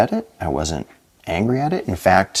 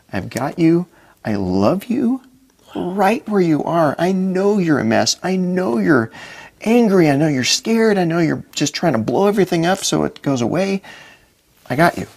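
God's reassurance; 8 semitones higher than usual. Retold or imitated. God's answer voiced in his head.

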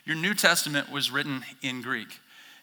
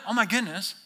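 ordinary talk

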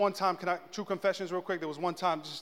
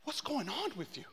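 higher, softer, near-crying wife; imitating tearful wife; 5 semitones higher, 8 dB quieter than usual. Wife asks raging husband, almost crying imitation. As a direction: plead softly, as if close to tears. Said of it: "almost, like, crying".

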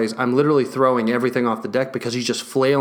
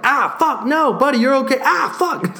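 much higher, louder yelps around soothing words; pained yelp; 13 semitones higher, 3 dB louder than usual. Retold or imitated. The owner getting hit by quills, higher pained yelps. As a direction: yelp in pain while calming the dog.

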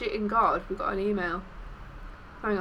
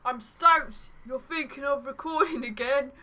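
higher-pitched put-on Australian accent; Aussie accent; 5 semitones higher than usual. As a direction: read it as a hammed-up Aussie. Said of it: "should have done that in an Aussie accent".

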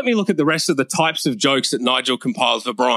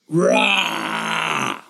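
long drawn-out "rah" roar; rough roar. Acted. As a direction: burst in with a growling roar. Character bursts in roaring.

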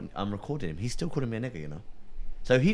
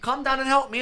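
exasperated plea; 13 semitones higher, 4 dB louder than usual. Retold or imitated. Repeating his plea loudly.

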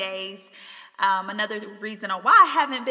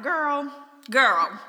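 put-on sassy, higher and louder girlfriend talk; sassy self pep talk; 5 semitones higher, 6 dB louder, much slower than usual. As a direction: hype yourself up like a bestie. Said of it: "I have to talk to myself like that sometimes".

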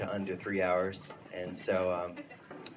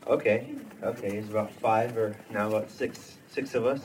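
normal talking voice

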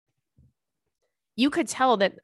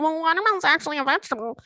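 smug, quick, higher mock pedant; know-it-all correction; 7 semitones higher, much faster than usual. Mocking the people who correct her about watermelon, smug. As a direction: mimic the smug fruit-fact corrector. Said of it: "You know people who do that".

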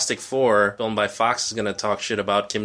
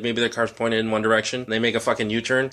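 ordinary delivery

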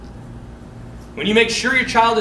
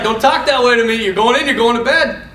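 louder, higher retold telling-off; scolding dad; 5 semitones higher, 6 dB louder than usual. Dad scolding son who talked back, imitated stern. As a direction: imitate a father cracking down.

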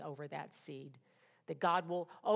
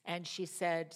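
ordinary delivery

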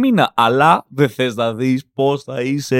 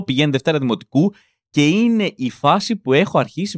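usual speaking voice

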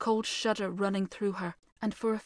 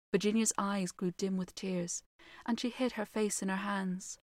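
ordinary delivery, nothing marked

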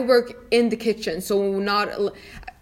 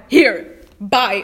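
louder mock brush-off; fed-up dismissal; 7 dB louder than usual. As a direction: act out a friend sending a customer away. Fed-up friend hands over new burger.

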